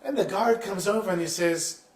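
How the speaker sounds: ordinary talk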